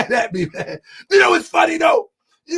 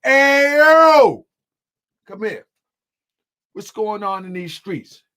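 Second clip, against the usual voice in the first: acted street call; 3 dB louder, slower than usual. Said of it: "put the camera off and say".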